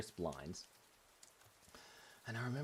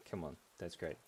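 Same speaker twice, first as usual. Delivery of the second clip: ordinary talk, nothing marked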